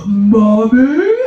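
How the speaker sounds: put-on loud teen boy calling, rising at the end; a hint of a newly broken voice, belted out; son's changed voice. Son calling mom after puberty, loud changed voice. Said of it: "Their voice changes so fast".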